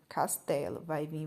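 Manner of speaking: usual speaking voice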